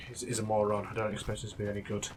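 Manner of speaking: ordinary talk, nothing marked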